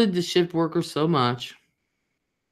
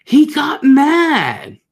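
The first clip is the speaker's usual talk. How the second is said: high and loud, dragged out slowly; outraged, much higher and louder, slow; loud, slow emphasis; 11 semitones higher, 7 dB louder, much slower than usual. Real, not acted. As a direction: hit it hard and slow, indignant. Indignant retelling of angry customer.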